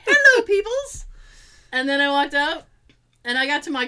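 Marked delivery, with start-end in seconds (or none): 0.0-1.1 high, cutesy little dog voice. Retold or imitated. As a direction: give the wiggling puppy a squeaky greeting. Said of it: "He's like".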